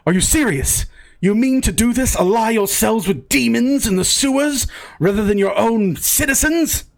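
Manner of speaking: high-pitched, appalled protest; indignant disbelief. Acted. Villager accuses traveler of siding with demons, indignant. Villager outraged at being threatened.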